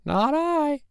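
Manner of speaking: high meowing cat refusing; cat's high meow and lazy no. Acted. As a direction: meow it, then refuse lazily.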